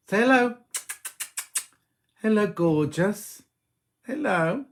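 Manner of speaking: high, drawn-out hello to a cat; cooing at pet. Real, not acted. Streamer coaxes the cat to greet viewers, high cooing.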